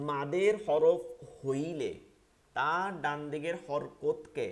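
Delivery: deliberate teacher dictation pace; slow dictation. Shown as a demonstration. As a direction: spell the rule out slowly for students to memorise. Teacher dictating the rule slowly.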